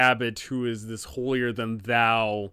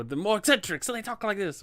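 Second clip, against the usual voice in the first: sketch an oddball NPC voice; eccentric voice; 7 semitones higher, faster than usual. Shown as a demonstration. DM showing players an eccentric NPC, pitched higher.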